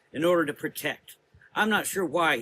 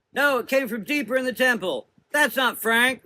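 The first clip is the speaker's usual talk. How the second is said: much higher, louder cry; 11 semitones higher, 7 dB louder than usual. A narrator's reading. Rose defending Frank, crying out. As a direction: cry it out in protest. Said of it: "Rose cried out".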